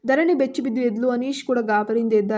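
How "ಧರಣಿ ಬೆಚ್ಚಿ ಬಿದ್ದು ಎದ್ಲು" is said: ordinary delivery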